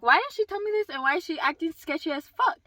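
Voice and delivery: loud, high-pitched indignant retelling; retold anger. Daughter fuming over mom's secrecy, retold indignation. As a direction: demand it in mock outrage. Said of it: "I was angry".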